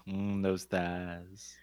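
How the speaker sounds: slow, low savoring moan; lustful murmur. Pretend. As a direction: savor it like a glutton. Savoring thighs jokingly.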